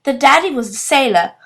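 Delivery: usual speaking voice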